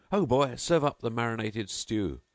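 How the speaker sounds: host calling out for an imaginary stew; crying out. Acted. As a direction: call for the stew grandly. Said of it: "he cried out again".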